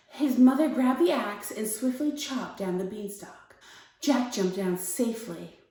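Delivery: calm, measured bedtime-story read; story narration. A narrator's reading. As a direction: read a fairy tale gently to young children. Reads a story aloud.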